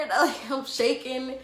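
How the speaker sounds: ordinary talk, nothing marked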